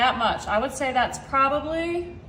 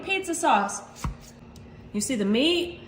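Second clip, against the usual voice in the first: ordinary delivery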